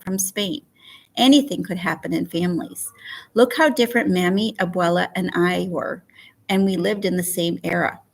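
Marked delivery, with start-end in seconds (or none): none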